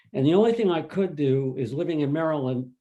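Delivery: ordinary talk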